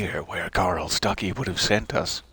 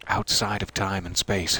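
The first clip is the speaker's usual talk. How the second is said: ordinary talk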